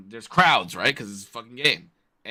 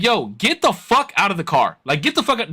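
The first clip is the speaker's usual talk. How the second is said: high, heated order; furious demand; 7 semitones higher than usual. Retold or imitated. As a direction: order him out of the car. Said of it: "So I'm like".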